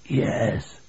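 hushed, hoarse whisper; whispered, bleak admission; faint, drifting word. A narrator's reading. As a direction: let it drift out softly, almost voiceless. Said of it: "one word drifted to Billy's ears".

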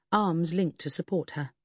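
ordinary delivery, nothing marked

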